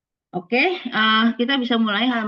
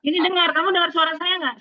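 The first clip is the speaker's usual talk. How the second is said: to someone hard of hearing; 8 semitones higher, 4 dB louder, faster than usual. Real, not acted. Checking audio in online class.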